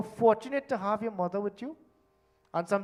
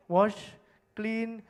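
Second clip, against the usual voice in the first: ordinary talk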